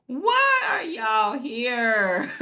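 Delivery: slow, loud, laugh-cracked wail; laughing mock yell. Real, not acted. Vlogger voicing the son's frustration, loud laughing wail.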